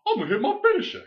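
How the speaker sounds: very high, loud put-on quote; hammed-up excited imitation; mock wrestler boast. Quotes Big E announcing his move.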